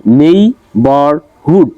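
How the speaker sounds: নেইবারহুড in three separate beats, নেই-বার-হুড; syllable by syllable. Shown as a demonstration. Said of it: "তিনটা syllable দেওয়া আছে".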